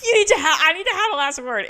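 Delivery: hammed-up shrill insistence; squeaky mock demand. Cohost mocking Kathy's insistence, high squeaky demand. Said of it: "and she's like".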